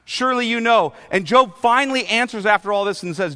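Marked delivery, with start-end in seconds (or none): 0.0-0.9 high, relentless sarcastic grilling as God; pressing, sarcastic interrogation; sarcastic questioning. Acted. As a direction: pile up mocking questions, pitched high. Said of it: "God was sarcastic for four chapters".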